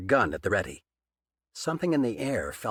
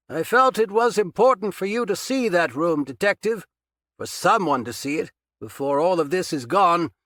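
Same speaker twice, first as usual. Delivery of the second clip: loud, higher, calm speech across the room; calling from afar; 7 semitones higher, 5 dB louder than usual. A narrator's reading. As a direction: lecture him loudly from across the basement. Captor speaking from far off.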